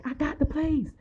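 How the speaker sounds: high, squeaky happy announcement; lightly hammed-up proud caller; mock excited man. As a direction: pipe it up like giddy news. Man announces news on phone.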